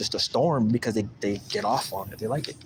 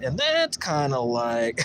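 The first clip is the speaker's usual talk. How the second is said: usual speaking voice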